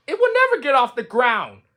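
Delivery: high, whiny giving-up voice; a fed-up little toad, high and whining; despairing Toad. Acted. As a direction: whine like you've had enough. Toad repeats robins' jeer.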